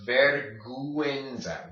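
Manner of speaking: vergüenza with a clear goo-EN; vergüenza, carefully as ver-goo-EN-za; sounded ü. Shown as a demonstration. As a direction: stretch out the U in vergüenza. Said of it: "makes it make a U sound".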